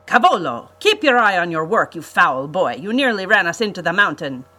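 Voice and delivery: loud, furious scolding; the Count yells at Cavolo for nearly crashing; angry shout. A narrator's reading. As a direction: bark at the foul boy. Count scolds the boy pilot.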